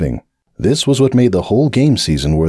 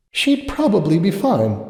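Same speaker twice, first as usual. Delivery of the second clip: complaining to herself; 10 semitones higher, 3 dB quieter than usual. Acted. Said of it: "she complained to herself".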